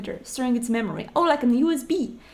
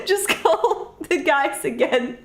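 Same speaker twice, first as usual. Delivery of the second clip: laughing; 4 semitones higher, slower than usual. Real, not acted. Laughing while asking for ice cream.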